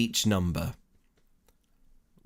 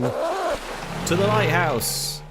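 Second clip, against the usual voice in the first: high, drawn-out call to adventure; rallying call; 11 semitones higher, 4 dB louder, much slower than usual. Pretend. Streamer announces the next destination, grand rallying call.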